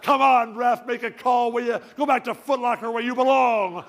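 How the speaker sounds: loud, high-pitched heckle at a referee; yelling at the refs. Retold or imitated. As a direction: yell it across the court in protest. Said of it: "yelling at the refs".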